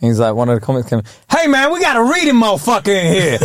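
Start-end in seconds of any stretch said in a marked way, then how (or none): 1.3-3.4 high-pitched mock heckle; mock heckler. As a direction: call out a reader, half laughing. Comic heckles a reader.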